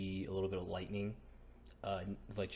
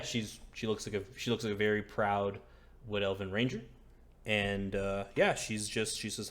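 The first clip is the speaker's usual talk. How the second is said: normal talking voice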